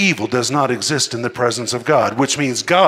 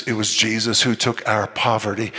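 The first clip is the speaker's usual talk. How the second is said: ordinary talk, nothing marked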